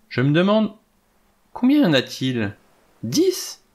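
rising delight, jumping high on Dix?; Vera's excited cry over the figurines; exclaiming. A narrator's reading. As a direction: count them out with growing glee. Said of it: "s'écria-t-elle".

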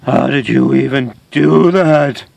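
slow, effortful, incredulous question; strained disbelief. Acted. Battered host to producer, strained disbelief. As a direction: force the question out slowly.

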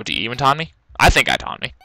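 usual speaking voice

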